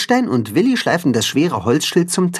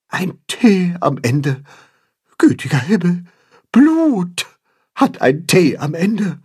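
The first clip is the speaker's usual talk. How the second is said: Grimm, frightened; 7 semitones higher than usual. A narrator's reading. Goose Grimm trembles at the letter T, frightened. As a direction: falter in horror, pausing between phrases. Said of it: "flüstert Grimm zähneklappernd".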